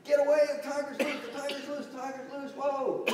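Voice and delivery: high, fast mock-panic yelling; acted-out terrified shouting; panicked screaming. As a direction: warn the crowd in frantic alarm. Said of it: "screaming, yelling".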